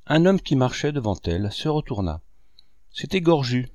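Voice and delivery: ordinary delivery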